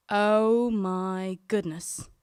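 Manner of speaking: low, very slow, drawn-out disbelief; shocked. Retold or imitated. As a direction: stretch it out in stunned dread. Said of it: "in shock".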